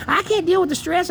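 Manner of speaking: high, whiny imitation of mom; mocking mother. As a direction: parrot her excuse in a put-on voice. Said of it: "has made up this whole thing".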